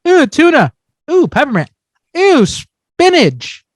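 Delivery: high, swooping mock surprise; mock taste reactions. Player imagining random flavors, mock surprised reactions. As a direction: play each flavor as a fresh little discovery.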